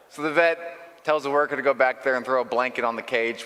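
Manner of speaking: ordinary talk, nothing marked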